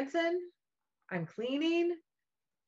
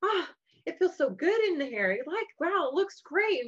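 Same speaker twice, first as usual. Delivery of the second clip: put-on impressed kids; 6 semitones higher than usual. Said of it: "my kids are like".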